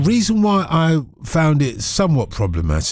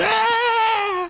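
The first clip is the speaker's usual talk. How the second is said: mock scream. Scream remembering monkeys.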